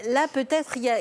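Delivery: ordinary talk, nothing marked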